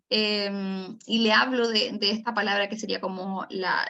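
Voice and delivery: ordinary talk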